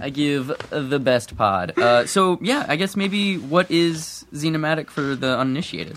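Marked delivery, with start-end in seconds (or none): 0.0-1.8 slow, drawn-out "I give the best pod"; slow mock boast. Host boasting jokingly about the podcast, slow and drawn out. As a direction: stretch out each word of the boast. Same boast repeated much slower.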